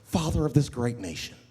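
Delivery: a hint of a starstruck tourist.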